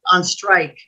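ordinary delivery

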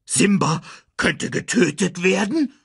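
high-pitched, horrified question; shocked. A narrator's reading. Pumba realises Simba could be killed, shocked. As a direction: let alarm push the voice up. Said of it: "Pumba schnappte nach Luft".

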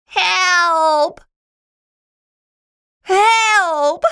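high, drawn-out cry for help; panicked shout. A narrator's reading. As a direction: shout for rescue, high and loud. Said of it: "shouted Schroeder".

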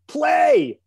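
loud, high imitated shout. Bandleader cueing a drummer who missed his entry, mock shouting. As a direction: yell it like an urgent cue to a lost drummer. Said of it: "[name]'s going".

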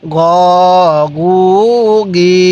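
"গ, গু, গি" each held long and level; measured syllable chant of "গ, গু, গি"; drawn-out vowels. Shown as a demonstration. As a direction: stretch "গ, গু, গি" with pauses between. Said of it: "হ্যাঁ, পড়ুন".

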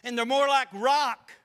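loud, driving pulpit preaching; fiery preaching. Acted. Preacher warning his church against drink, loud and driving. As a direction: hammer each phrase home to the congregation.

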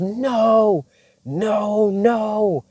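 high-pitched, drawn-out wailing imitation; devil going crazy. Devil caught out by her in prayer, high crazed protest. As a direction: mimic a frantic devil, voice shooting way up. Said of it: "the devil be going crazy".

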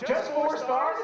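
high, loud mock outrage; can't believe it's only four; offended disbelief. Driver protesting the passenger's rating, high indignant. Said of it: "driver offended by rating".

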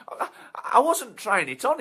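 high, loud pleading; nervous karaoke bloke. Acted. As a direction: protest weakly. Bloke protesting.

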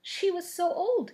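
kindly Witch of the North, lightly voiced; old witch. A narrator's reading.

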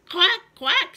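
high, loud quacking; duck quack. Acted. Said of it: "said the duck".

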